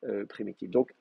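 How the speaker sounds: ordinary talk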